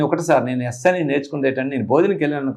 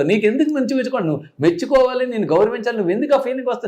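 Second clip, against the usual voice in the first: higher-pitched voice of the old instructor, lightly imitated; lecturer's lesson retold; 8 semitones higher than usual. Quoting the academy lecturer to cadets, in a lightly imitated higher voice. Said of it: "అంటాడు".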